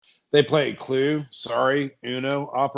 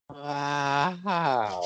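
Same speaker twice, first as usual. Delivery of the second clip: put-on awed child voice; 6 dB quieter than usual. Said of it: "Todd the Bod says".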